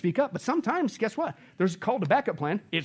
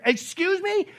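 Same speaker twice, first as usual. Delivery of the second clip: much higher, sharply incredulous "excuse me?"; indignant disbelief; 12 semitones higher, 3 dB louder, slower than usual. Real, not acted. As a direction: pitch it up in outrage at the idea. Rhetorical challenge repeated higher.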